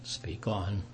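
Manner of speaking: slow, soft mumble; mumbled. Acted. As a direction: mumble it reluctantly. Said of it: "Saul kind of mumbles".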